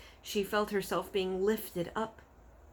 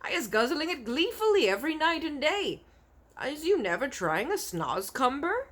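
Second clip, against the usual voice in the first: friendly giant voice; 9 semitones higher, 4 dB louder than usual. Acted. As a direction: savour every word like a friendly giant showing off.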